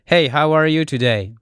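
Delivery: "Hey, how are you today?" in a proper English accent; showing off real English to French friends. Shown as a demonstration. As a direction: switch into genuine English. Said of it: "Donc ça, c'est du vrai anglais".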